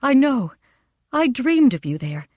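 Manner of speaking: high, loud burst of delight; excited cry. A narrator's reading. Mother running to meet her husband and daughter, crying out excitedly. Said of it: "she cried".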